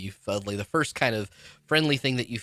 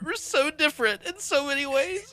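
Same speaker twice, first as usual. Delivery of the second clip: very high, laugh-choked voice; real overwhelmed laughter; 5 dB louder than usual. Real, not acted. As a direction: squeal it through helpless laughter. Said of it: "The emotions that I have felt".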